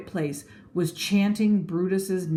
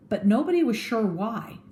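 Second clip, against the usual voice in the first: plain reading voice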